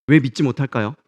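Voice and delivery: normal talking voice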